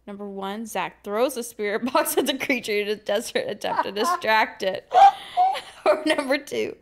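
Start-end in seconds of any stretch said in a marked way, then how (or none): 5.0-5.8 high loud laugh; burst of laughter. Real, not acted. Laughs during narration.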